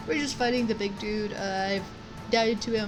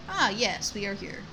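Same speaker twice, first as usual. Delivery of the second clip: usual speaking voice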